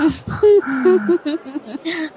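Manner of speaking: a short, disbelieving laugh that rises in pitch; scoffing laugh. Acted. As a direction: laugh at his boasting, half mocking. Laughs at his vanity. (tawang nang-iinis)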